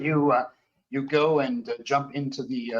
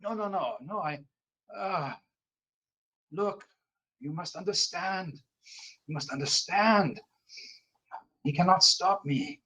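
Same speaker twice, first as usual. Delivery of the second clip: put-on softer, higher, pleading French Canadian accent; 5 semitones higher, 3 dB quieter than usual. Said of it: "French Canadian, uh, voice".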